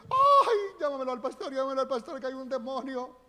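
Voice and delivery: high, loud hammed-up whimper; mock panicked wail. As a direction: beg for the pastor in mock terror. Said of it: "estamos diciendo". (lamento burlón de pánico)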